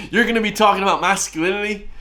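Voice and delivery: high, derisive question straight out of a laugh; incredulous mockery. Real, not acted. Reactor ridicules the masculinity talk, incredulous. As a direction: throw it back at him in high-pitched disbelief. Mocks the clip after laughing.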